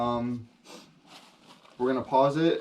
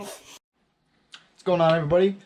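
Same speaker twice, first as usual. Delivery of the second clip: vlog greeting; 6 semitones higher, 8 dB louder than usual. Acted.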